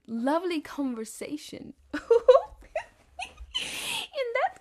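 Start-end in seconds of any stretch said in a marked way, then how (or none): 1.9-2.8 a shrieking burst of laughter; loud high laugh. Real, not acted. Amused by her chat with the friend, loud squealing laugh.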